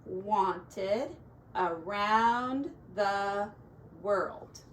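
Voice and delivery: drawn out very slowly, word by word; slow reading. Shown as a demonstration. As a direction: read the sign slowly again for the child. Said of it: "she wrote it right here".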